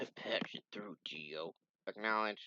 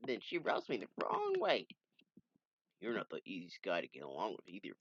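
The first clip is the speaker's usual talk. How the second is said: ordinary delivery, nothing marked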